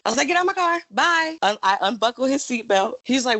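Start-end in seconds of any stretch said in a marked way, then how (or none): 0.3-1.4 kicking him out. Retold or imitated. Kicking date out, retold.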